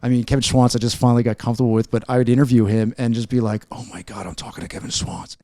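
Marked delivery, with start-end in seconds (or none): 3.7-5.4 much softer, lower, almost whispered awe; hushed starstruck aside. Retold or imitated. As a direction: drop to a near-whisper, imitating a starstruck interviewer. Retells being starstruck while interviewing his hero.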